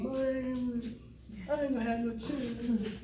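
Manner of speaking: slow, drawn-out mock whine; mock bellyaching. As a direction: moan it out, imitating your own complaining. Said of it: "I, and I belly ache".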